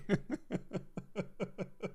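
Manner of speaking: high, soft tailing-off giggle; real laughter. Real, not acted. Streamer laughing at viewer's lottery comment, high soft giggles. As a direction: giggles thinning into breath.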